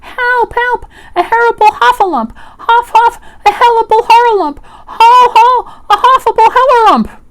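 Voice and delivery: very high, loud panicked crying out; frantic tongue-tied squeals of alarm; Piglet. A narrator's reading. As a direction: cry for help while fleeing, words tumbling into muddle. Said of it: "still crying out".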